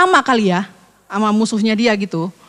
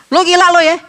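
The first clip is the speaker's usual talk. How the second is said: sharp mock retort, louder and higher; snapping back; 5 semitones higher, 4 dB louder than usual. Husband snapping at soothing wife, mock angry retort. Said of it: "istrinya bilang".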